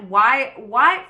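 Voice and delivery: loud, punchy disbelief; baffled protest. Retold or imitated. Storyteller protesting the casting she was given, baffled and loud.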